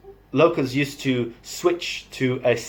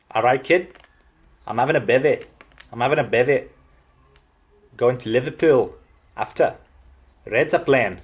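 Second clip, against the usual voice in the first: Liverpool lilt, rising ends; casual Liverpudlian accent demo; Scouse accent; faster than usual. Shown as a demonstration. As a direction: do a chatty Scouser.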